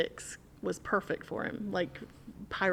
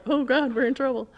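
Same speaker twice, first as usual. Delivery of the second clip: mock panic; 5 semitones higher, 6 dB louder than usual. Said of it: "They were like".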